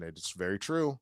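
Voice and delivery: ordinary delivery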